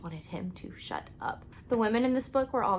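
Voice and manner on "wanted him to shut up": fed-up whispered aside. Real, not acted. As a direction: whisper it under your breath. Whispered repeat of complaint.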